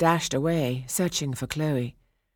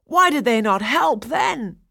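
high, loud bitter challenge; 8 semitones higher, 7 dB louder than usual. A narrator's reading. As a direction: throw the question back bitterly. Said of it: "Daphnis said bitterly".

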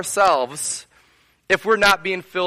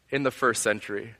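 ordinary delivery

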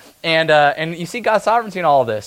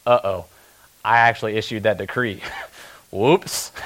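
sheepish uh-oh of a tipsy king; drunk king; 5 semitones lower than usual. Retold or imitated. King realizing he signed the decree, sheepish. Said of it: "he starts to realize".

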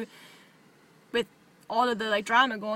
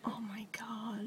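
breathless whispered gasp; hushed, awed whisper; 2 semitones lower, 16 dB quieter, much slower than usual. Real, not acted. Gasps at cosplay idea.

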